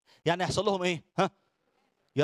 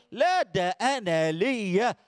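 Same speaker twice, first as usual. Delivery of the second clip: ordinary talk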